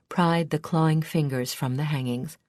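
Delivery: plain reading voice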